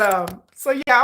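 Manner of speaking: usual speaking voice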